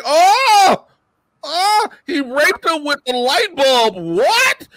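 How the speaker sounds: high-pitched drawn-out disbelief; shocked viewer. Acted. Viewer reacting to a horrifying SVU case, in shocked disbelief. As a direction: squeal it out, appalled and incredulous.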